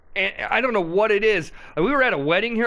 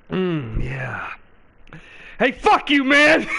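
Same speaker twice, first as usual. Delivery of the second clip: mock angry outburst; 3 semitones lower, slower than usual. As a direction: snap back at the world after a sip. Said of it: "He puts the bottle to his lips".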